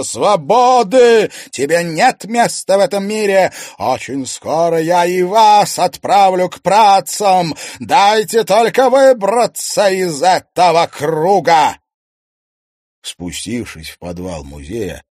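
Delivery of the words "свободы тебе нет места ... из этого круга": slow, high, loud ghostly threat. Acted. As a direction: menace them as a vengeful spirit.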